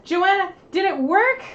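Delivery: loud, high call across the room. Real, not acted. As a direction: call her name to get an answer. Calling out to someone off-camera.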